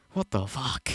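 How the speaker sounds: usual speaking voice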